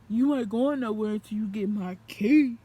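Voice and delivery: booming demand to stay; loud threat. Retold or imitated. Boyfriend threatening her not to leave, loud. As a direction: bark it, blocking the way.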